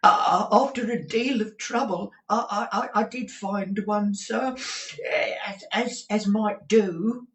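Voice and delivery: high, stuffy voice of a man with a cold; hesitant client with a heavy cold. A narrator's reading. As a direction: sniffle it out. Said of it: "in the voice of a sufferer from a constitutional cold".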